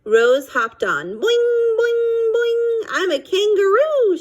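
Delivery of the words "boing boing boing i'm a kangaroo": high, bouncy hollered boings; squealing boing boing as she jumps; hollering kangaroo. A narrator's reading. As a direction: shout it like a kid bouncing on a trampoline. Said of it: "she hollered".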